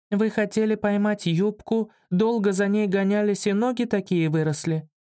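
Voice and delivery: put-on high, innocent child voice; curious little girl. A narrator's reading. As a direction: ask earnestly like a small child. Little girl questions the narrator.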